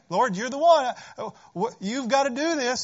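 high, loud plea; desperate prayer. Pretend. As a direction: cry out to the Lord for help.

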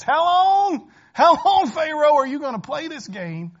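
high, loud exasperated cry of how long; servants' desperate outcry; pleading servants. Acted. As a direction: plead with the king in alarm.

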